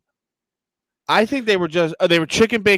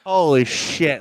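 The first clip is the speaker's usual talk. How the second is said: usual speaking voice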